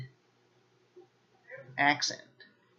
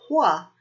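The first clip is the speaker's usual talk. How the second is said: Hua punched much higher and louder; stress on Hua; 7 semitones higher, 8 dB louder than usual. Shown as a demonstration. Teacher singling out Hua for learners, punched loud. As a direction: hit the word Hua hard and high. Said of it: "Hua can mean an accent".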